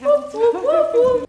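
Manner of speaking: high, loud cheering whoops; excited whooping. Real, not acted. Cheering while food cooks.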